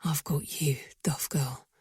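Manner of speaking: deep whispered male murmur; quiet deep reassurance; low murmur. A narrator's reading. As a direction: soothe her in a low whisper. He murmurs again holding her.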